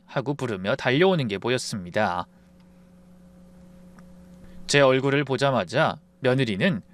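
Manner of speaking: ordinary delivery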